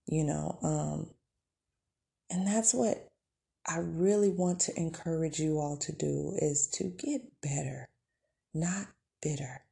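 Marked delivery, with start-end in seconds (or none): none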